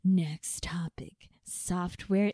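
slow whispered announcement. Acted. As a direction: hush it like a secret.

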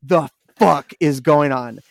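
slow, spaced-out bafflement; stunned disbelief. Retold or imitated. Player sees friend's name on banner, stunned. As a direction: draw each word out as if dumbfounded.

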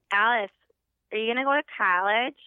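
imitated Minnesota family accent. Family asks Alice about college, imitated Minnesota accent. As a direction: put on a Minnesotan relative's accent. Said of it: "I didn't have an accent".

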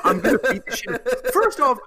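high, laughing outburst. Real, not acted. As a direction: burst out laughing while talking. Laughing outburst after impression.

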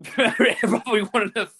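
slow, laughing through the words; laughing admission. Real, not acted. Answering the co-host about being sober, laughing. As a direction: chuckle it out, conceding the point. Admits drunk fault, laughing.

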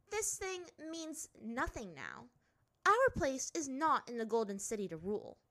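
high, sharp princess voice; a proud girl brushing it off; haughty princess. A narrator's reading. Azula dismisses headpiece.

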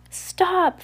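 high-pitched call to halt; crying out. A narrator's reading. Violet calls to the islanders as the children approach, crying out. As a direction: call out a high, urgent stop. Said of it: "Violet cried when they were close enough".

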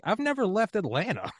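very high-pitched, incredulous protest; mock indignant. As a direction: imitate a friend squeaking in disbelief. Friend's indignant comeback, retold.

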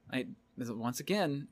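usual speaking voice

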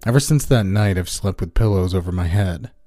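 ordinary delivery, nothing marked

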